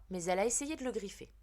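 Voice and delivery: usual speaking voice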